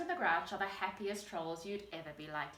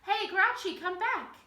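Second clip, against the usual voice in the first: loud, high call across the garden; troll calls loudly after his friend; 10 semitones higher, 9 dB louder than usual. A narrator's reading. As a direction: shout after him to come back. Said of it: "he called".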